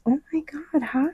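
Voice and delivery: breathy excited whisper; whispered surprise at seeing a friend. Retold or imitated. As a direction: whisper it like a thrilled greeting. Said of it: "she goes".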